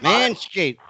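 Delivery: ordinary delivery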